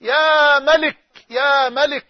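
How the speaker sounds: slow loud cry fading with each repeat; retold shouted plea, dying down. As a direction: call out to the King, then let it ebb. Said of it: "فظللت أصرخ".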